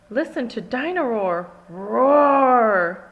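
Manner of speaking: loud toy voice with a big roar; toy dinosaur roar. Acted. The toy waking everyone at night, loud roar.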